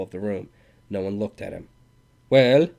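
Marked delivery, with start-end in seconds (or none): 2.3-2.7 loud, insolent challenge. A narrator's reading. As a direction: throw it out cockily. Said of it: "he cried insolently".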